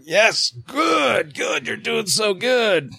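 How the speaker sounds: high, drawn-out cheering of a doctor; doctor's encouragement. Retold or imitated. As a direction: cheer her on in a high, stretched voice.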